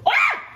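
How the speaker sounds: sudden high yell; jump-scare shout. Real, not acted. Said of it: "scare me like that".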